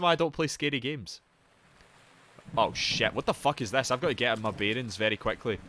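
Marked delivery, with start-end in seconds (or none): none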